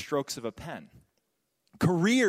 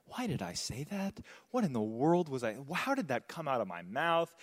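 softer, half to himself, wincing at his own words; regretful muttering; 3 semitones lower, 5 dB quieter than usual. Retold or imitated. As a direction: mutter the regret under your breath. Retells his own regretful thoughts.

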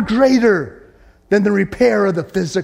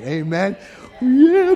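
ordinary talk, nothing marked

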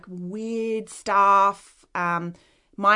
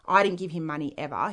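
ordinary delivery

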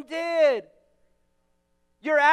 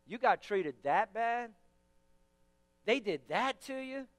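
mock sympathetic friend; 4 semitones lower, 9 dB quieter than usual. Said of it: "We like the attention".

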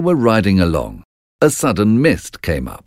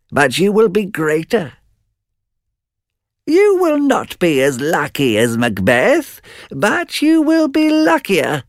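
witches prophesying; 9 semitones higher than usual. Acted. Witches answer in turn.

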